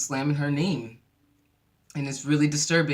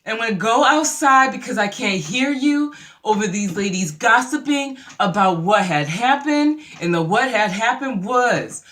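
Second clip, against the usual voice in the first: loud, raised, showy declaration; imitating a woman announcing her exit to the room; mock public announcement; 6 semitones higher, 7 dB louder than usual. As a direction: project every word for the eavesdroppers, stretching the end. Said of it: "she makes an announcement, basically".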